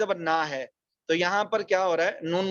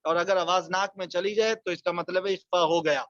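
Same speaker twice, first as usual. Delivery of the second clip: ordinary talk, nothing marked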